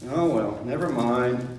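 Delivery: normal talking voice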